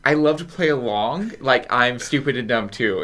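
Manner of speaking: ordinary delivery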